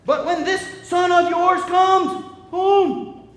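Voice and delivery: resentful complaint. Acted. Older brother complains to his father.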